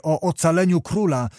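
plain reading voice

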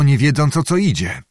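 usual speaking voice

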